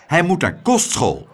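hoger, licht roepend. A narrator's reading. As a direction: roep het beslist uit. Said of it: "riep mevrouw Cocklesmith". (gedecideerde uitroep)

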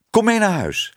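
high, eager call; high-pitched, happy summons. A narrator's reading. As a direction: call them along excitedly. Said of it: "riep Tibbe".

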